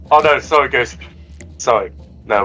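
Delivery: ordinary talk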